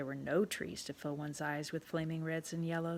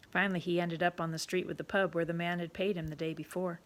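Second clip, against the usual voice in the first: plain reading voice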